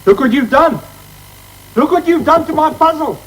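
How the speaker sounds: heated family shouting. Acted. Quarrel over a puzzle.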